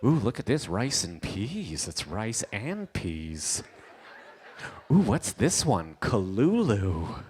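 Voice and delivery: slow, mock-amazed tourist; wowed Canadian diner. Canadians reading the menu with no clue, slow mock wonder. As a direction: sound out each dish in slow wonder. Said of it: "They're like".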